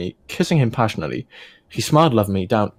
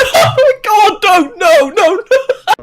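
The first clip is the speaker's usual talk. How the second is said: laughing shriek; 8 dB louder, much slower than usual. Real, not acted. Grossed out, laughing.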